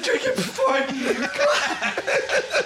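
very high, slow laughing quote; laughing retelling of the heckle. Retold or imitated. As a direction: wheeze it out through laughter. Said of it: "And you said".

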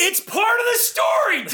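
high-pitched exasperated shout; exasperated outburst. Acted. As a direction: yell it at someone who keeps interrupting. Mock outburst at being interrupted.

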